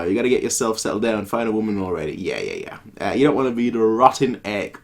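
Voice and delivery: ordinary talk, nothing marked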